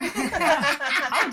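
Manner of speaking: high, loud, laughing Southern howdy. Pretend. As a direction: toss out a hammed-up cowboy howdy. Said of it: "Put a howdy in there".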